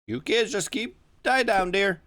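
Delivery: put-on high, smooth older man; Mister Tolliver.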